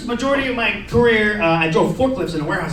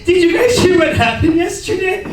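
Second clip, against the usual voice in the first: gossiping coworker; 8 semitones higher than usual. Retold or imitated. Coworker spreading break room gossip, mock-scandalized. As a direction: draw out the shock, pitched way up.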